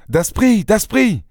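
high-pitched cry of the name; calling out. A narrator's reading. Narrator calling his friend back by name, crying out. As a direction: call him urgently without extra volume. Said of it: "criai-je".

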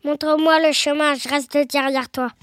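low monster voice; voix du Gruffalo. Acted. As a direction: speak deep as the big beast. Gruffalo speaks in the story. (grosse voix grave de monstre)

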